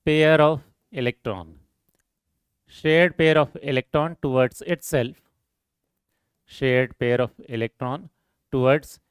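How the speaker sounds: very slow, word-by-word dictation pace. Acted. Teacher dictating a definition to students, slow and measured. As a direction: spell out the definition so students can write it down.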